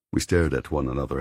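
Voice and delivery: ordinary talk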